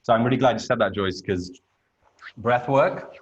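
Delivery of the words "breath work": normal talking voice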